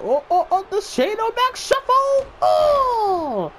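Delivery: squealing high with excitement; high-pitched hype over the shuffle; excited high yell. Real, not acted. Hyped by the Shane shuffle.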